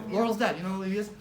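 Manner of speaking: ordinary delivery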